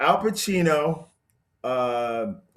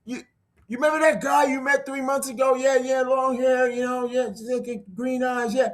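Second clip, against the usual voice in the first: higher, eager phone chatter; excited phone call; 9 semitones higher than usual. Retold or imitated. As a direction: tell a friend on the phone excitedly. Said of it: "he calls him up and he says".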